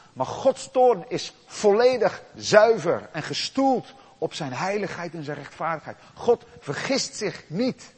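loud, emphatic sermon voice; forceful preaching. Acted. Preacher proclaiming God's pure wrath to the congregation, forcefully. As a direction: thunder out the point about wrath.

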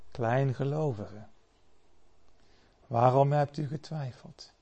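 soft, tender reproach; gentle rebuke. Acted. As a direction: speak quietly, like a patient teacher. Jesus gently rebukes Peter.

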